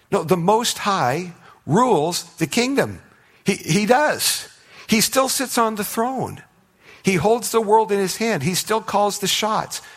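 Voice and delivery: high, loud, slow preacher's proclamation; soaring pulpit declaration; preaching at full force. Acted. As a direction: proclaim it to the back row, slowly. Preaching climax on God's rule.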